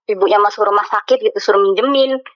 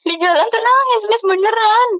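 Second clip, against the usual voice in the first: pitched way up in disbelief; exasperated; 12 semitones higher than usual. Real, not acted. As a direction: squeal it out in exasperation. Exasperated complaint about crying boyfriend.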